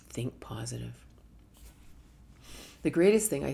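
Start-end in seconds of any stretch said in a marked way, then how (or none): 0.1-0.9 soft, sarcastic think positive; mocking the advice, hushed; mocking whisper. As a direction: whisper the cliche with a sneer. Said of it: "everyone's saying".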